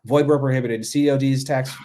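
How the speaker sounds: loud hard-sell announcer read; infomercial voice. Shown as a demonstration. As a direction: punch it like a late-night infomercial. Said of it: "that infomercial voice".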